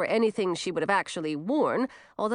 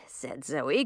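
plain reading voice

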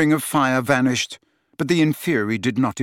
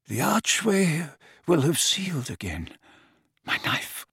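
faint, failing whisper; fading, slurred and breathless; weak slurred voice; 6 semitones higher, 3 dB quieter than usual. Acted. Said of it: "slurred Dumbledore's voice".

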